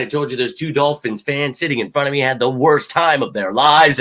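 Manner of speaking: normal talking voice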